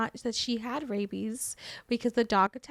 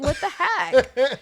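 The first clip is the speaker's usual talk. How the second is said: laughing disbelief; 4 dB louder, much slower than usual. Retold or imitated. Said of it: "I was like".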